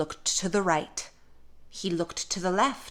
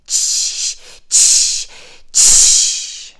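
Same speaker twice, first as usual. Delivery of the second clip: three loud hissed monkey tsks; loud voiceless "tsh" bursts, evenly spaced; 10 dB louder, much slower than usual. A narrator's reading. Monkeys mocking the peddler, chattering "tsh". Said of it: "and said".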